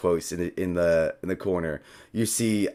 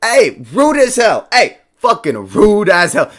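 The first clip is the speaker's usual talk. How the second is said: offended yelling; 11 semitones higher, 11 dB louder than usual. Acted. Mimic yelling at the guests as it transforms. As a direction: drag out the outrage, loud and high.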